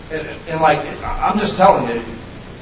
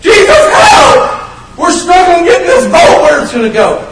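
desperate cry for help; 11 dB louder than usual. Pretend. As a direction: scream for help like sailors in a storm. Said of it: "did not cry out like".

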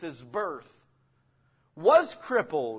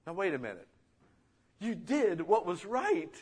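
normal talking voice

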